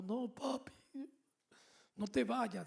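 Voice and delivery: put-on high, pleading girl's voice, a touch tearful; imitating his crying teenage daughter; tearful daughter's plea. The daughter begging her father not to leave, high and tearful. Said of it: "me dice Chaide llorando".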